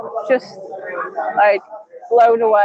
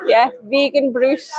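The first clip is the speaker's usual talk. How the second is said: ordinary talk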